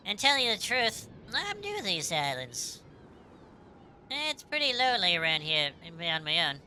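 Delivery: attempted Australian accent, lightly put on; friendly, lilting go at an Australian accent; Kid. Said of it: "if I could do an Elstarian accent".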